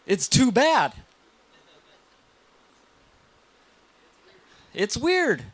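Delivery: high, pained cringe at own voice; dismayed reaction. Retold or imitated. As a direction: react like hearing your own recording. Imitating audience hearing own recording.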